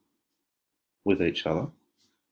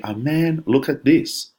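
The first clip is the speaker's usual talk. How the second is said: much higher, thrilled cry of discovery; excited exclamation; 9 semitones higher, 5 dB louder, slower than usual. Shown as a demonstration. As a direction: read it like you just found treasure. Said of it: "Exclamation mark".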